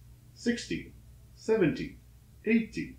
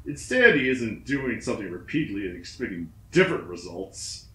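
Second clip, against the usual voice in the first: ordinary delivery, nothing marked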